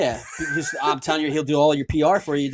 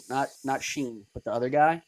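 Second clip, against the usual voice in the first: normal talking voice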